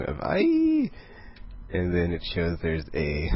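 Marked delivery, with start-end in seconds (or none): 0.2-0.9 very high, sustained mock scream; mock shriek. Off-panel scream in a retold comic, high mock shriek. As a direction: imitate a shriek from offstage. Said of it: "this, this shriek, this scream".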